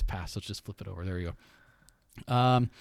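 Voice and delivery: usual speaking voice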